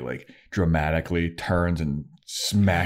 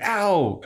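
high-pitched "ow" in anticipation; mock pained yelp; 13 semitones higher than usual. Willie faking a beating for Buffy, mock pained yelp. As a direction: yelp before the hit lands. Fake flinch before a punch.

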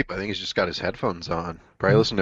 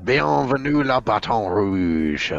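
put-on French accent; much slower than usual. Mock French greeting.

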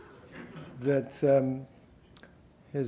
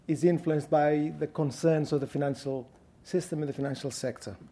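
ordinary talk

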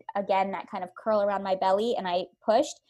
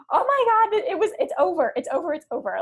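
high, loud rush of relief fading down; thrilled relief winding down; elated; 9 semitones higher, 8 dB louder than usual. Retold or imitated. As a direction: burst out with joy that it's done. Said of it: "that sense of, like, elation".

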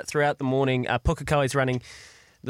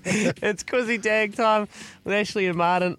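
quiz show announcer; 4 semitones higher than usual. Acted. Host announcing the quiz segment.

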